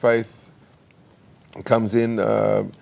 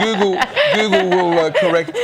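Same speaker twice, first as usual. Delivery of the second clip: high laughing burst, much louder; 8 semitones higher, 6 dB louder than usual. Real, not acted. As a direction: laugh through the words. Joking about misspelt name.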